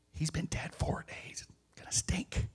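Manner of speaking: hushed, voiceless aside to Jesus; whispered warning. Acted.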